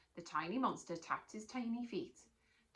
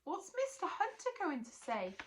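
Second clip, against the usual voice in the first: worried; 10 semitones higher than usual. Acted. Natalie worried.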